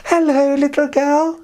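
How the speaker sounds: high, sugary wolf greeting; sly wolf. Acted. The wolf greets the girl, high and sugary. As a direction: sweet-talk the girl like a friendly wolf.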